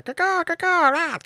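high-pitched imitated raptor cawing; raptor screech. Game master voicing the raptor skull as it breaks, high squawk. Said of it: "that's the raptor one. So you hear it's like".

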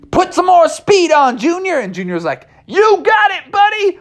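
very high eager yell; high eager cheering; excited shout. Acted. Cheering speed.

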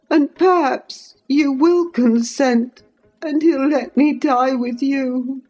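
put-on high-pitched, desperate pleading boy; acted sobbing. A narrator's reading. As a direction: beg tearfully for your life, pitched high. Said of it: "he sobbed".

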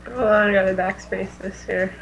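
normal talking voice

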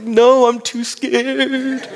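high, drawn-out mock whine; starstruck fear. Fan refusing wife's urging to say hi, comic starstruck fear. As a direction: play up being too timid, stretching it out slowly. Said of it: "why was I afraid".

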